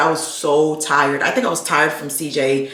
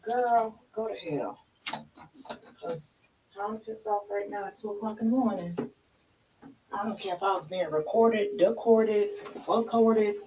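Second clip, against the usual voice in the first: caught on camera; 12 dB quieter than usual. Real, not acted. Cussing at Ring camera.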